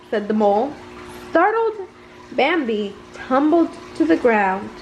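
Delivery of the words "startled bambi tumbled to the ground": ordinary delivery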